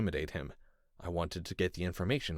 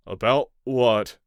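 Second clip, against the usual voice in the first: less confident; 4 semitones higher, 10 dB louder, slower than usual. A narrator's reading. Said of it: "He sounded less confident now".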